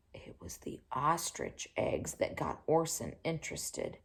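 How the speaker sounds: breathless gasp, then soft whispered line; hushed whisper. A narrator's reading. Reader hushes to build wonder for the child, whispering. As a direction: draw the listener in with a secret. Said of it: "storyteller builds suspense".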